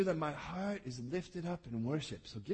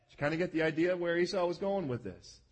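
ordinary delivery

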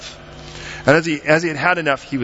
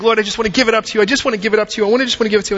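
fast, higher-pitched pleading; hurried surrender plea; 9 semitones higher, much faster than usual. Acted. Believer trying to give it up to the Lord, hurried pleading. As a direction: rattle off an insistent plea, pitched up.

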